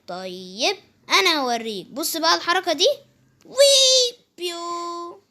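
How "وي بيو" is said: slow playful whoop. Acted. Jump sound while playing.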